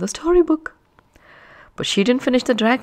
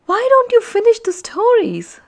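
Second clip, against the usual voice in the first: put-on much higher, louder pleading boy; boy pleading over the chaos; 14 semitones higher, 5 dB louder than usual. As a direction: beg the class to stop. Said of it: "Zach pleaded".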